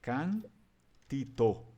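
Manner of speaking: Cantito drawn out very slowly; Venetian verb form. Shown as a demonstration. Teacher modelling the inflected verb, very slow. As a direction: say Cantito syllable by syllable. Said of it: "eu vou declinar ele".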